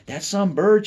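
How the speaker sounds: mother's friend admiring the turkey, high and loud; gushing lady. Acted. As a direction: coo over the bird in a high lady voice. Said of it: "she said".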